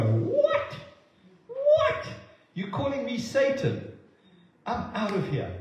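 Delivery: high, incredulous squawk of shock; offended disbelief. Retold or imitated. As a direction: play Peter stunned and insulted. Peter's imagined offended reply.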